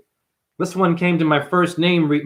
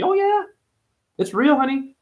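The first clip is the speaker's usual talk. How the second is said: much higher sassy teasing; sass it like a know-it-all; 11 semitones higher than usual. Pretend. Sassy high voice.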